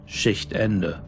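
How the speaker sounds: ordinary delivery, nothing marked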